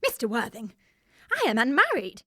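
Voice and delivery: loud, scandalised outcry; indignant protest. Acted. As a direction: recoil in loud, offended dignity. Indignant at being called mother.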